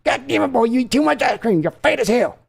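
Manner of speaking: scolding father. Acted. Father scolding his son for eating ice cream, high angry tirade.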